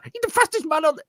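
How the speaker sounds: high, mock German accent; hammed-up German accent impression. Friend imitating De Bruyne in a German accent. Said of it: "in a German accent".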